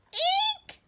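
very high whispered squeak; mouse squeak. A narrator's reading. Frightened mice squeaking at the big stranger. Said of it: "they squeaked".

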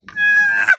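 very high fangirl shriek; excited scream. Real, not acted. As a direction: let out a piercing delighted squeal. Screaming over the book.

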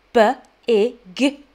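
B-ig split into sounds; sounding out words. Shown as a demonstration. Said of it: "Blend the sounds together".

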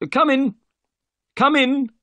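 high, loud call to visitors. A narrator's reading. Unseen man summons guests through open door, calling out. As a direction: sing out loudly in a very high voice.

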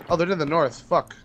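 normal talking voice

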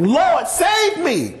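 high, slow plea of a sinking man; mild mock cry; cry for help. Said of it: "he cried out".

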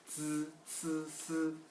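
즈, 츠, 스 slow and level, tongue flat; flat-tongue sibilants. Shown as a demonstration. Teacher repeats the three sounds, flat-tongue sibilants. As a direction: sustain 즈, 츠, 스 evenly off the teeth. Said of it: "굉장히 편 상태에서".

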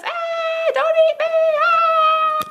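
very high playful scream; frightened prey animal. Pretend. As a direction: shriek like tiny prey. Pretend being eaten by dinosaur.

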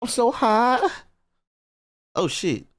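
ordinary delivery

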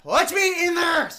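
frustrated shout. Real, not acted. Frustrated gamer yelling at game.

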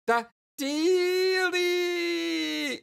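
very high, hugely drawn-out squeal; ecstatic squeal. Acted. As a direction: stretch DEALIE into a long shriek. Same word drawn into a squeal.

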